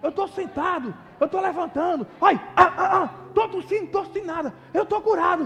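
loud, high reenactment of an overjoyed caller; excited healing announcement. Retold or imitated. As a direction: act out astonished joy, rising into shouts. Retold phone call announcing a healing.

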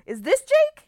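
high, loud mock disbelief; exaggerated, insistent asking; mimicked incredulous question. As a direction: imitate a friend squealing the question. Mimics friend's repeated puzzled question.

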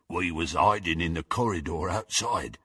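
low, soft grunted delivery; grunting henchman. A narrator's reading. Goyle explains to Harry where they hid, low grunt. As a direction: grunt it out as a low-voiced lout. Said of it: "grunted Goyle".

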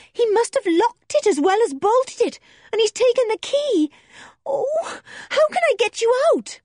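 put-on a frightened girl desperate to help; girl pleading through the door; distressed young girl. Distressed girl Joe voiced.

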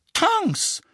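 high shocked cry of a name; horrified cry. A narrator's reading. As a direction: call out in alarm. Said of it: "rief Misses Weasley entsetzt".

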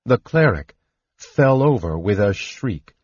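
ordinary delivery, nothing marked

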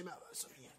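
breathy voiceless rasp of a broken voice; voice cutting out. Retold or imitated. Singer showing how his broken voice sounded, airy and failing. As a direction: let the voice fail into breath. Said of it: "Eu falava assim".

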